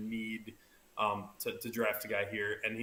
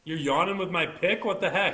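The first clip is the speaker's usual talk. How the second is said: mock outrage; 6 semitones higher, 7 dB louder than usual. Mock outrage at cohost yawning.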